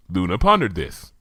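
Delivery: ordinary delivery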